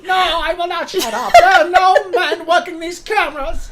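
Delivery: high, loud, laughing mock executive; shrill up the voice and laugh through it as the boss; mock Kathy. Playing Kathy to her co-host, high laughing mock voice.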